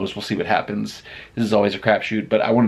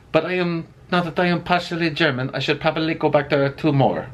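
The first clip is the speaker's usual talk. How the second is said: a hammed-up German accent, pitched much higher; bad German accent; 8 semitones higher than usual. Vlogger joking about going back to the restaurant, in a bad German accent. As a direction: play a clumsy German, proud to be partly German. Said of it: "That was the worst version of the German accent I've done yet".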